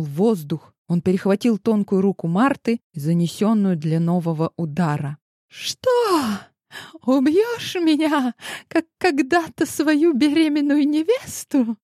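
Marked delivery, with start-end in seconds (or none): 5.5-11.8 feral wasteland raider. A narrator's reading. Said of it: "Безумно захохотала Марта".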